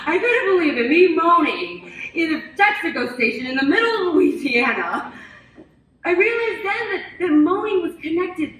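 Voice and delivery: loud, high-pitched amazement; astonished exclamation. Acted. Astonished climax of childhood story.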